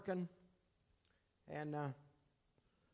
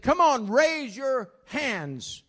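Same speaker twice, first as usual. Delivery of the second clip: urging call; 6 semitones higher, 7 dB louder, much slower than usual. Retold or imitated. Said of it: "And so he said".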